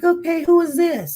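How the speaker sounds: calling out alarmed at the late knock; startled midnight question. Retold or imitated.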